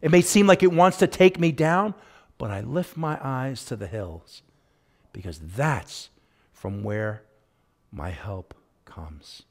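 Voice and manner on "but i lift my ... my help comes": hushed to a whisper. Acted. Preacher to the congregation, fading into a slow whisper. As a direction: drop softer line by line until the last words are a slow whisper.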